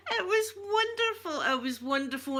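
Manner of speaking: choked-up happy crying; real tearful crying. Real, not acted. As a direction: let the voice crack with happy tears. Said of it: "I'm gonna cry".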